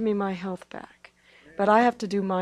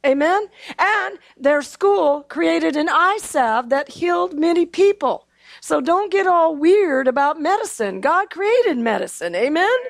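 raised, higher preaching voice calling for amens; 5 semitones higher, 3 dB louder than usual. Acted. Preacher rousing the congregation toward an amen, preaching. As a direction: rouse the congregation and ask for an amen.

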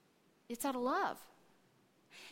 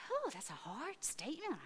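taken aback; 4 semitones higher, 8 dB quieter than usual. Retold or imitated. Retold doubtful reaction to remark.

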